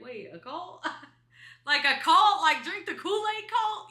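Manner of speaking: high, loud, laughing disbelief; shocked disbelief. Retold or imitated. Retelling her reaction to boyfriend's mom, laughing shocked disbelief. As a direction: squeal it out like you can't believe it. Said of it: "And I was like".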